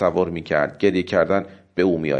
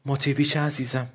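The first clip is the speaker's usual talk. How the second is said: higher, softer, halting disbelief; stammering; 6 semitones higher, 4 dB quieter than usual. A narrator's reading. Wife calls in shock to her husband in the crocodile, stammering softly. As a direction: gasp it out with a catch in the throat. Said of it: "النا که زبانش به لکنت افتاده بود گفت".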